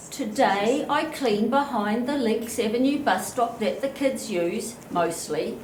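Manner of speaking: usual speaking voice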